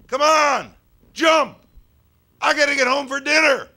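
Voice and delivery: very high, full-out shout; impatient yell. Acted. Man in parking lot to jumper on roof, impatient yell. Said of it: "looks up at me and yells".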